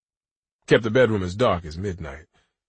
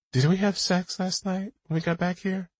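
higher, lighter woman's voice; female character voice; 11 semitones higher than usual. A narrator's reading. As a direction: voice her as a young woman. Rachel voiced in dialogue.

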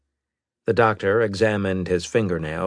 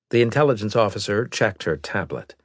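usual speaking voice

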